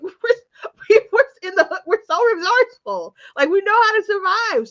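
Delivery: high, loud, amused protest; laughing retort. Retold or imitated. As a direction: proudly protest through laughter. Own indignant retort, laughing.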